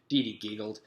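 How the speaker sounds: plain reading voice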